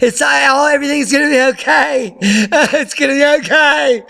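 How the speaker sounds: high, laughing parody of a newsreader forcing a smile; mock her strained smile, squeaky and giggly; mocking fake cheer. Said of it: "She's trying to keep a smile on".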